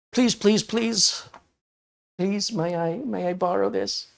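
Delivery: high, hammed-up pleading; mock begging. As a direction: beg like a child for a toy. Begging to borrow the device.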